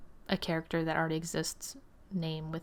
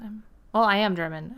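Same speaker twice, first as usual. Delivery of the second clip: normal talking voice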